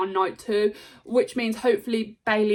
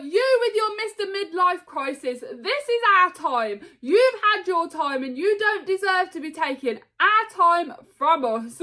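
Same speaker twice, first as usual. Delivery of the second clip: a bit higher, mocking; lightly voiced taunt, higher; 8 semitones higher than usual. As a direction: sneer at him like Seth. Seth taunting Rock, retold.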